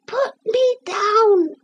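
very high, slow little squeak; teeny tiny voice. A narrator's reading. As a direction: speak as a tiny wooden figure. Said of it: "said the voice again".

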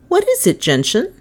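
high-pitched teacher calling on a pupil; teacher questioning. Acted. Miss Todd to an idling pupil, questioning. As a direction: pitch it up sharply, rising on the name.

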